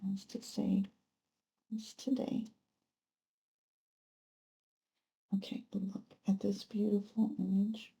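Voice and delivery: soft hushed whisper; awed whisper. Real, not acted. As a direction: breathe the words in awe at the card. Whispers over the drawn card.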